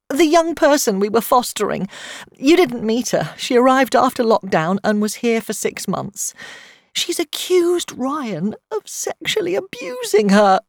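tearful, rising high into a wail; distraught. A narrator's reading. Friend revealing her son has been accused, distraught.

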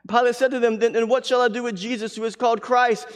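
high, loud preacher's reading; forceful scripture reading. Acted. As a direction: proclaim the passage to the congregation. Reads scripture at full force.

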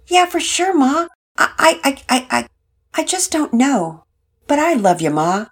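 put-on a hopeful kid pleading with his mom; teen son.

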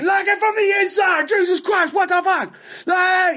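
frantic, very high-pitched yelling; Pete going off. Retold or imitated. Club owner going off at comic locking a door, imitated frantic yell. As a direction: screech it in an exasperated imitation. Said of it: "he's just going off".

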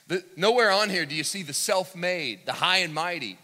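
loud, high pulpit proclamation; preaching at full force. Acted. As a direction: ring out each phrase of the prophecy to the hall. Preaching against the proud.

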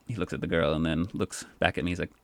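ordinary talk, nothing marked

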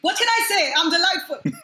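high, giggly boast; real laughter bubbling through a playful brag; laughing self-praise. Real, not acted. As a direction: joke about yourself while laughing. Joking self-praise amid laughter.

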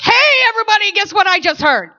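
high, loud holler to the room; excited announcement. Acted. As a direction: call out big news to everyone.

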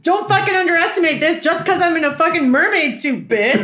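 high-pitched, indignant warning; fierce put-on impression of a scary woman; mock threat, pitched well up; Nor. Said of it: "And her other big line".

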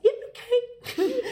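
high, slow bleating goat voice. Retold or imitated. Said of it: "Little goat voice".

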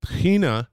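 Tahina with a breathy H, stress on the end; Israeli pronunciation. Shown as a demonstration. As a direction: Tahina said high, the Hebrew way. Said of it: "is what it's called in Israel".

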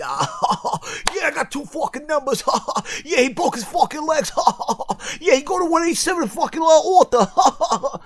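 high, fast, giggly mourner voice; mock laughing mourner. Mocking funeral guests, high giggly chatter. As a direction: play a careless mourner cackling at a funeral. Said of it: "imitating laughing funeral mourners".

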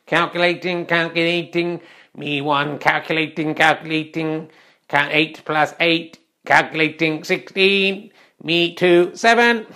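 monotone calculating machine, halting word by word; robot voice. Acted. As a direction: work out the sum like a clunky computer. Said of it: "I wouldn't like to be robot voice".

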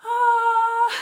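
a high, loud, breaking cry of relief; tearful gasp of joy. Real, not acted. To her viewers on hearing her friend is clear, a high tearful gasp. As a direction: let out a joyful tearful gasp.

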